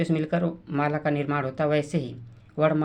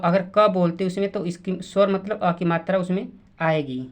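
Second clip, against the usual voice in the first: ordinary talk, nothing marked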